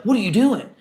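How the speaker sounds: sharp, high, loud outburst at the new hire; imitating a boss who loses it; retold angry yell. Pizza owner catching him adding sauce, yelling. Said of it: "he loses his mind on me".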